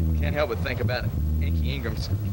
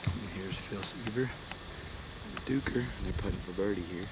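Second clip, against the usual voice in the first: hushed golf announcer; 3 semitones lower, 9 dB quieter than usual. Pretend.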